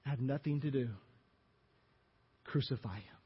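soft, low, resigned crucify him; quiet verdict. Acted. Pilate washing his hands of Jesus, low quiet resignation. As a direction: hand him over under your breath.

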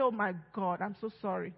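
soft contrite confession to God; remorseful prayer. Retold or imitated. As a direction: pray quietly like a guilty believer asking forgiveness. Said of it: "falls into that sin and says".

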